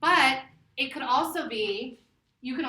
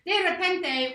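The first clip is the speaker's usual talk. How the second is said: "De repente" lifted high and hit hard; emphatic; 8 semitones higher, 5 dB louder, slower than usual. Shown as a demonstration. Teacher drilling "De repente" again for students, high and punchy. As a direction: announce "De repente" like a headline.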